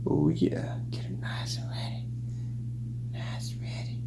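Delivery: breathy playful whisper over the dish. Acted. Science host whispering to viewers while adding soap. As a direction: hush it like a secret.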